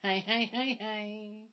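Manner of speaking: a bad put-on American greeting; whack American accent. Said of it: "I'm doing that whack American accent again".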